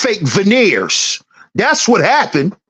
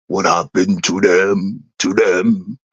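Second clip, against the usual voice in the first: much lower Jamaican dancehall deejay voice; 3 dB quieter than usual. Retold or imitated. Mocking everyone's failed hustles in a much lower Jamaican deejay voice. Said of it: "In my bounty killer voice".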